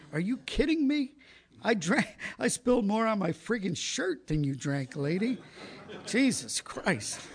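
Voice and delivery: high, loud, indignant rant; hammed-up fury at a lady; retold outrage. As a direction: let the incredulous anger fly upward. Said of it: "I was so angry".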